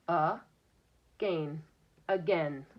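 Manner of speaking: "A, gain" split in two beats, then "again" joined; teacher breaking "again" into syllables. Shown as a demonstration. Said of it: "break this with our finger".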